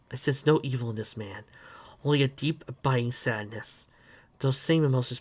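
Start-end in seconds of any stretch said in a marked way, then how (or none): none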